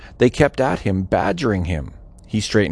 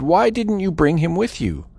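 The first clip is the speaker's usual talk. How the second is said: higher-pitched, mildly demanding question; narrator's restrained demand, pitched up; 6 semitones higher than usual. A narrator's reading. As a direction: ask it as an understated demand for an explanation. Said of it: "who demanded".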